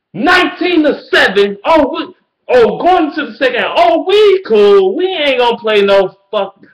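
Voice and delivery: high, loud mock-celebrating coach voice; sarcastic coach gloating about the lead, high and loud; mocking cocky coach. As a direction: ham up a smug coach sitting on a lead. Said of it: "Don't be like".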